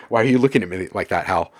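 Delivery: loud, quick mock-alarmed question; suspicious. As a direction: challenge the AI with playful distrust. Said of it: "like, so suspicious".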